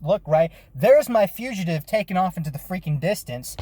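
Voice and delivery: usual speaking voice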